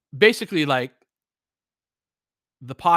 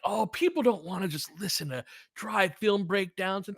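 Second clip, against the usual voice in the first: a hint of a whiny sceptic; mocking critics' voice; 7 semitones higher, 5 dB quieter than usual. Podcaster mocks critics who call film breakdowns dry, high mock voice. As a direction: lightly mimic the naysayers. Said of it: "the argument was always".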